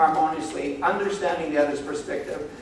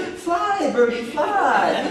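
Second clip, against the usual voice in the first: mock sing-song send-off; 8 semitones higher, much slower than usual. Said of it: "respond to that by saying".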